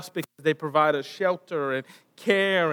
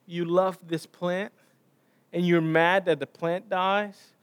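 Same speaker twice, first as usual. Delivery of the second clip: ordinary talk, nothing marked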